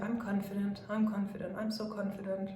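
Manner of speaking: soft, doubtful murmur; quiet, unsure. Shown as a demonstration. Coach showing the weak version of an affirmation, soft and doubtful. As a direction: say it as if you barely believe it. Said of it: "either you're saying, like".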